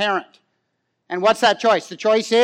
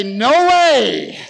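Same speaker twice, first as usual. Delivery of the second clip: loud, drawn-out no way; emphatic refusal; 4 semitones higher, 4 dB louder than usual. Acted. Preacher voicing parents who refuse lifelong live-in children, emphatic. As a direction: call it out as the crowd's resounding vote. Calling out congregation's emphatic vote.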